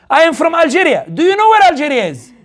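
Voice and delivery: mother's voice pitched well up, with a laugh; imitating mother. Son imitates his mother answering the Uber driver, high and laughing. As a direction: play the mother, high and chuckling. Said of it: "and my mother goes".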